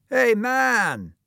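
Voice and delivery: a shouted mocking greeting; loud call. A narrator's reading. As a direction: call out across the playground with a smirk. Bully calls out to Tom.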